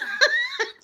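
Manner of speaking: high, loud burst of laughter; real loud laugh. Real, not acted. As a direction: laugh out loud mid-sentence. Laughing mid-story.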